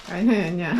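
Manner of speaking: ordinary delivery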